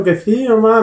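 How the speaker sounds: high, mock tearful insistence; a hammed-up whimpering yes; crying daughter. Daughter insists to her mother, mock tearful and high. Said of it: "Mira la niña llorando".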